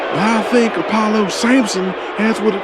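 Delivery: high, loud ring-announcer shout; hyped announcer call. Acted. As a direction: sell the champion to the crowd. Ring announcer hyping the winner.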